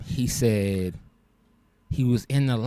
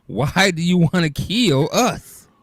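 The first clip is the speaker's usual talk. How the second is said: higher, louder, slowed pleading quote; mock whiny gamer; 8 semitones higher, 5 dB louder, slower than usual. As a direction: plead like a wounded teammate, drawn out. Friend's complaint acted out.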